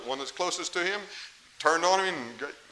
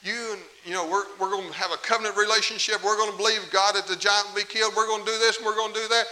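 loud, higher cheering brother; rally him like a hopeful brother would; hoped-for cheering; 6 semitones higher, 6 dB louder than usual. Retold or imitated. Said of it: "David was expecting his brother to say".

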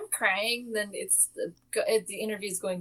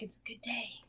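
excited stage whisper; imitated awed whisper; mock hushed awe; 2 semitones higher, 12 dB quieter than usual. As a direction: whisper it like a student sharing good news. Imitating students' hushed delight.

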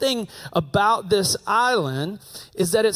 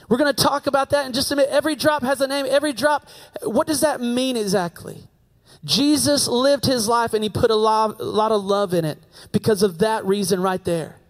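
higher, fervent sermon build; preaching; 5 semitones higher than usual. Acted. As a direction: preach it out with rising conviction. Preaching builds to full force.

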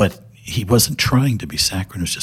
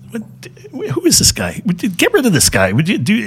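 high, loud, fast mock disbelief; exasperated Johnny Carson; 14 semitones higher, 4 dB louder than usual. Host wants the gentle guest gone, high exasperated squeal. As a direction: squeal it like a host at his wits' end. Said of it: "Johnny Carson says".

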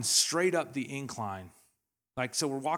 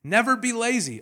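high, loud and punchy; 1 shouted exhortation; 10 semitones higher, 8 dB louder than usual. Acted. Preacher shouting the command at his church. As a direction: drive it home to the crowd.

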